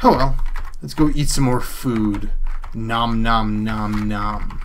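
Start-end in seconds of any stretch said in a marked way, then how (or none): 2.7-4.6 slow, drawn-out monotone nom-noms; stretched-out cartoon eating noises; pretend munching. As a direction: play at gobbling food, slowly. Playful eating noises over game food.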